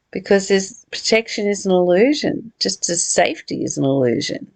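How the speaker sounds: ordinary talk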